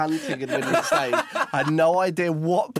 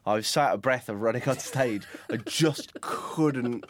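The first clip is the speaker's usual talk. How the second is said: ordinary delivery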